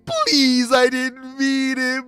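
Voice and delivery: high, loud wailing plea, stretched out; hammed-up cry for help; mock desperate begging. Raditz pleading after being pushed back, hammed-up wail.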